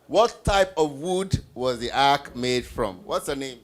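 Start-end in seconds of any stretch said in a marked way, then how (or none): none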